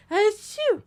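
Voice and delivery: loud, high sneeze sound; fake sneeze. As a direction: sneeze it out big.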